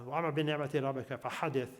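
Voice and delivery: Quranic Arabic said with its own sounds and rhythm; Arabic recitation. Shown as a demonstration. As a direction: recite "Wa amma bi ni'mati rabbika fahadith" in careful Arabic. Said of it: "this is the accent of the whole one".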